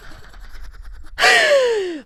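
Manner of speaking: long high-pitched gasp of delight; a fan gasping at a romantic line; high swooning gasp. Real, not acted. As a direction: gasp as if swept off your feet.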